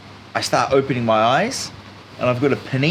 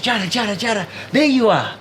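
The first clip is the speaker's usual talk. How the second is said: higher, calling his name in relief; receptionist calling out; 10 semitones higher than usual. Retold or imitated. Hostel girl greeting the lost traveller, higher relieved call. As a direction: call him like you've spotted him at last. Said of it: "she goes".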